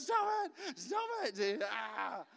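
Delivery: a wife's mock-panicked scream from the passenger seat; panicked shriek. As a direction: squeal at him to stop, half laughing. [name] panicking at manual drive.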